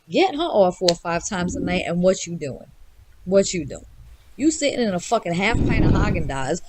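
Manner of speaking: normal talking voice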